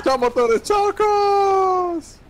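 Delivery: very high, loud panicked squeal; shrieking as it all falls apart; squealing goodbye. Real, not acted. Engine lost, plane crashing.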